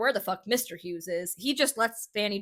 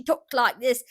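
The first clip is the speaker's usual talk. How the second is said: put-on Cockney accent; 3 semitones higher, 5 dB louder than usual. Host imitating Fanny for her co-host, Cockney accent. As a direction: put on a rough attempt at Cockney. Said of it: "with her Cockney accent".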